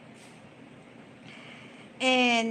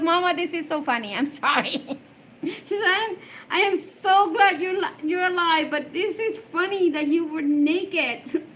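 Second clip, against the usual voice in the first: lightly voiced giggly daughter, higher and fast; amused daughter; 5 semitones higher than usual. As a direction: play the daughter apologizing through amusement. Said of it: "She goes".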